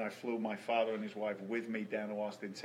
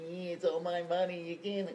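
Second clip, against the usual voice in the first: high mocking greedy whine; 7 semitones higher than usual. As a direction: sneer it like a greedy miser.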